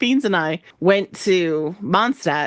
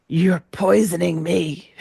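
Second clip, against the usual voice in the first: drawn-out, weakened dying voice; slow poisoned gasp; slower than usual. Retold or imitated. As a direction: drag it out like you're fading. Poisoned drunk man gasps it out.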